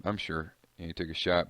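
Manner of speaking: normal talking voice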